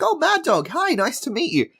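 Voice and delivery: high, gushing fan greeting; mock excited high voice. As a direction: squeal it out like a starstruck fan. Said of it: "She's all excited".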